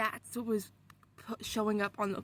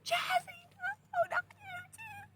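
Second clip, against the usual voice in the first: very high, dramatic wail to a sister; hammed-up high-pitched dismay; mock anguished plea; slower than usual. Retold cry to possessed little sister.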